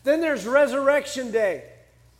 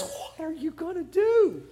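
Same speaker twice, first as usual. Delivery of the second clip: higher-pitched, comic private plea; aside to God; 5 semitones higher, 3 dB quieter than usual. Acted. Moses turning to God for help, in a high comic aside. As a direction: turn from the crowd and ask in a high, worried aside. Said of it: "turns and looks at God and says".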